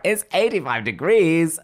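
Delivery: high, slow exasperated protest; exasperated teen. Retold or imitated. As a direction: protest like a fed-up teenager. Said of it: "So I'm like".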